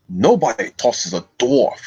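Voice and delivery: slow gruff-dwarf impression; Gimli. Streamer showing viewers an impression, Gimli voice. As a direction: declare it slowly as a proud fantasy dwarf. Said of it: "I can do my Gimli voice".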